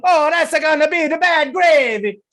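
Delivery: mock-lament in cartoon Italian; terrible Italian accent. Gamer interrupting the Zoom, wailing fake Italian accent. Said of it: "this terrible Italian accent".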